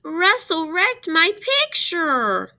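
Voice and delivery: high, loud, slow upset wail; upset complaint. A narrator's reading. Jamaica tattling to her teacher, high, loud and slow. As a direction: tattle in distress. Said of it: "Jamaica cried".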